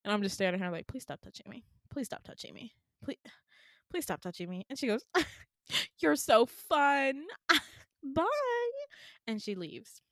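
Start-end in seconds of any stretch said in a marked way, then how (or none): 0.9-4.7 very soft, level repeated plea; quiet restrained plea. Retold or imitated. Patient to nurse poking her leg, quiet restrained plea. 5.1-8.7 slow, loud gush into a high singsong bye; gushing cheery goodbye. Retold or imitated. Nurse leaving the annoyed patient, gushing cheery goodbye.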